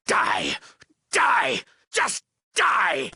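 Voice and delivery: high, explosive villain shout; loud, high-pitched burst of rage; furious death cry. Acted. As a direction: scream it as you attack an intruder. Villain ordering intruder's death.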